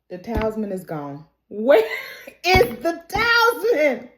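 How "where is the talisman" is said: drawn-out, rising incredulous demand; acted alarm. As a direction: stretch it out, ending high. Acting out shock at missing talisman.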